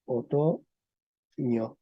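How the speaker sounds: "otoño" muy lento, separado en sílabas; pronunciación pausada. Shown as a demonstration. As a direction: pronounce "otoño" softly, stretched out syllable by syllable. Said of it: "Y lo pronuncio". (pronunciación lenta, sílaba por sílaba)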